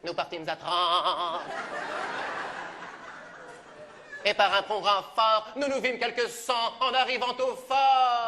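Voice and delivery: low, slow classical verse declamation; grand tragic recital. Shown as a demonstration. Corneille shows the producer how the verse goes, slow solemn declaiming. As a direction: deliver the line like a tragic hero on stage. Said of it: "Je le fais moi-même".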